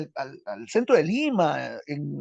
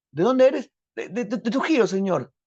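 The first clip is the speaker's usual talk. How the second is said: high, louder eager exchange; acted dialogue; 7 semitones higher, 5 dB louder than usual. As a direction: pitch up, eager and stammering. Diálogo Valdelomar y Vallejo. (diálogo actuado, agudo y nervioso)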